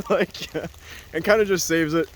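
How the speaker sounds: ordinary talk, nothing marked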